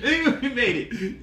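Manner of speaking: high, slow, laughing "Yeah, we made it."; gleeful laughing boast. Shown as a demonstration. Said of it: "You know".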